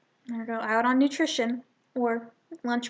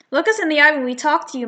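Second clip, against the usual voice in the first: louder, fast sneering taunt; bully taunt; 3 semitones higher, 7 dB louder, faster than usual. Acted. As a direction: press him, quick and mean. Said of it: "Frankie says".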